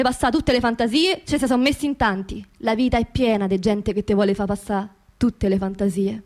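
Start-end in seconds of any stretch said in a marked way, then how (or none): none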